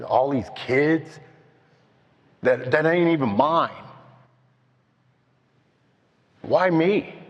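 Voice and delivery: retold exasperated appeal, voice rising; pleading with God. Man asking God why he must raise these kids, retold as a plaintive protest. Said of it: "I'm asking God".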